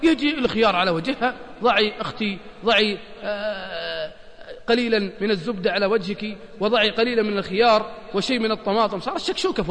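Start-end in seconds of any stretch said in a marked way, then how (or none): 2.7-4.1 slow, sing-song mock of a beauty advert. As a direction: stretch it out like a cheesy TV beauty tip. Imitating beauty ad voice. (تقليد ساخر لإعلان تجميل بصوت ممطوط)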